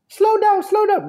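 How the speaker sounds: high squeaky slow down; high pleading voice. Retold or imitated. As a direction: plead like a tired car. Japanese car voiced.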